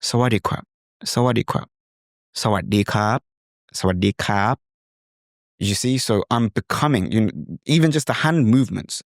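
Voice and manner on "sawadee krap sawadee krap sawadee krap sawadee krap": gentle Thai-style sawadee krap; softer Thai accent. Shown as a demonstration. Teacher becoming a Thai character, soft Thai accent. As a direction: greet politely with Thai tones. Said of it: "they're a lot softer".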